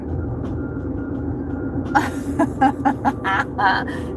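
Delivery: long breathy laughing fit; breathless laugh. Real, not acted. Driver cracks up at a viewer's comment, long airy laughing fit.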